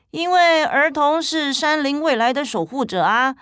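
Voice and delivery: a lightly voiced gentle parent. Parent explains to child.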